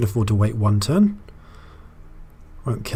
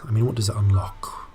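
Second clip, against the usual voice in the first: whisper; 5 dB quieter than usual. Real, not acted. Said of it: "whispering to himself".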